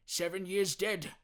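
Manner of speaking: put-on old Scrooge thinking aloud; musing old Scrooge. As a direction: muse on it uneasily. Scrooge voiced in story.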